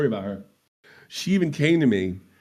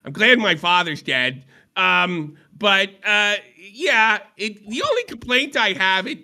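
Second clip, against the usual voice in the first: high, very slow put-on voice; slow, drawn-out sultry voice; Phantom impression; 7 semitones higher, slower than usual. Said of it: "give you another shot".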